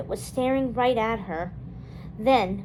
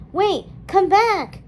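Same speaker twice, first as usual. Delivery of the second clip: high, loud, drawn-out yell; 7 semitones higher, 6 dB louder, slower than usual. A narrator's reading. Dawn yelling after her fleeing friend, loud and slow. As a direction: shout after her as she runs. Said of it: "Dawn yelled".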